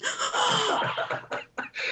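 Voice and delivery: sharp high gasp of joyful surprise. Acted. Olivia meets a fellow American, joyful gasp. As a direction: gasp in sudden relief.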